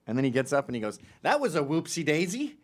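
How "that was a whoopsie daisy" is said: lightly voiced little kid, pitched up; a hint of a chirpy small boy; imitated five-year-old. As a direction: pop up like an unbothered child. Five-year-old pops up after fall.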